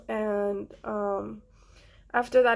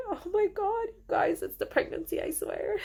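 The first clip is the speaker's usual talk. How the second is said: high, tearful voice breaking, then fading breathy; trying not to cry, voice cracking up high; real tears, pitch jumping up and fading to a breath; fighting tears; 9 semitones higher than usual. Real, not acted. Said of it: "I'm trying so hard not to cry".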